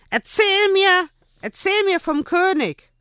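high, loud tantrum shout; boy shouting for the king story; demanding child. A narrator's reading. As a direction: scream the demand like a spoiled boy.

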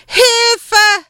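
high, loud desperate cry for help; shouting for help. A narrator's reading. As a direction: scream it from far off. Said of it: "Der Schrei kam von rechts".

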